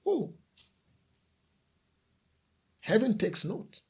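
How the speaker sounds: ordinary talk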